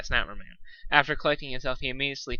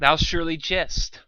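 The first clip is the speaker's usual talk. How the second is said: bellowed; 5 semitones higher, 5 dB louder, slower than usual. A narrator's reading. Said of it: "bellowed Night Marmoon".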